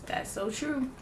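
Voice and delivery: ordinary delivery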